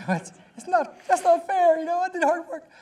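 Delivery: playful hammed-up protest; mock whine. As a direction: whine like a sulking child. Mock complaint after laugh.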